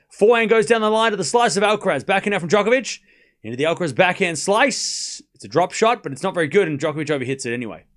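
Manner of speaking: casual tennis commentary, speeding up at the end; live play-by-play. Acted. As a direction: call the rally as it happens. Calls the rally live.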